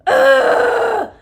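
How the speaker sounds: loud, high, sustained straining roar; full-out birthing bellow; imitated roar. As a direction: let out a long roaring scream. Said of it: "it was like a roar".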